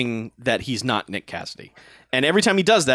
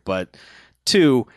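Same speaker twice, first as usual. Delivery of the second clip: usual speaking voice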